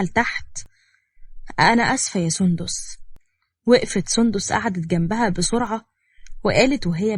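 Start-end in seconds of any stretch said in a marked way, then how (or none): none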